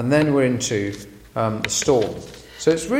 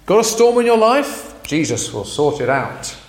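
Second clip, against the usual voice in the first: high, louder salesman cheer; mocking cheerful pitch; 4 semitones higher, 5 dB louder than usual. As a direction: parody the easy promise. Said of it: "you know the idea".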